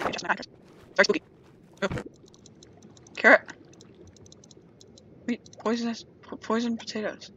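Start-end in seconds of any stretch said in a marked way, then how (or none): none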